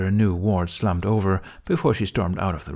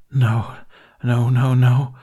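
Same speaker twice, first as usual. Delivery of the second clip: moaned no, rising louder; moaning; 4 semitones higher, 5 dB louder, slower than usual. A narrator's reading. Man despairing in healer's hands, moaning no. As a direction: moan it in despair, building. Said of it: "he moaned".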